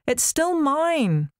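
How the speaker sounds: high, slow, stubborn whine; sulky whine. A narrator's reading. Athene insisting childishly.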